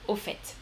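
au fait with the final T pronounced, "o fètt"; final T sounded. Shown as a demonstration. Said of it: "là, on prononce le T".

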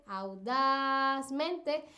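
audazmente with DAS drawn out slowly; slow audazmente, leaning long on das; stress on DAS. Shown as a demonstration. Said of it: "el acento prosódico está en ese das".

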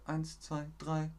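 hushed sneaky counting; soft count. Acted.

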